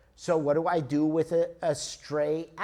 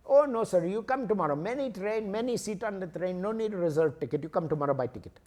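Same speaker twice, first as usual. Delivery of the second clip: Indian ticket clerk; 3 semitones higher, faster than usual. Acted. Indian clerk tells traveler to return tomorrow, Indian accent. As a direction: brush the customer off in an Indian English lilt. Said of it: "So there's this guy".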